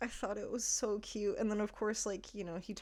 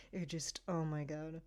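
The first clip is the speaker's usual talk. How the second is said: ordinary talk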